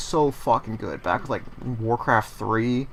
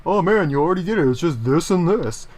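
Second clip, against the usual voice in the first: fast, higher, louder know-it-all voice; mock backseat viewer; 5 semitones higher, 5 dB louder than usual. A viewer telling him it is easy, fast mock know-it-all. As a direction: rattle it off like a smug viewer. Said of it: "looking at this and going".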